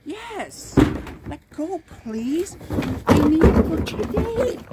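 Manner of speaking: high desperate yelling; pleading shout over the cans. Real, not acted. Trespasser fighting for trash cans.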